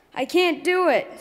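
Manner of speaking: high, loud screech of panic. Acted. Chicken, too scared to fly, screeching high and loud. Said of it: "screeched Chicken".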